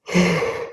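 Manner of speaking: long audible yawn; big yawn. Real, not acted. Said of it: "I'm sorry for the yawn".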